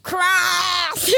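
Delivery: high, yelped mock Australian; hammed-up Australian cry; Australian accent. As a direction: squawk it in a bad Australian accent. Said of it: "Australian accents?".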